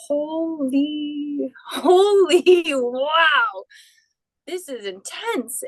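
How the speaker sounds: overwhelmed, half laughing; awed exclamation breaking into a laugh; stunned awe. Retold or imitated. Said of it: "And I was like".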